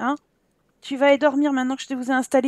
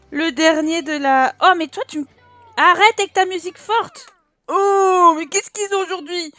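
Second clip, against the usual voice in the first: normal talking voice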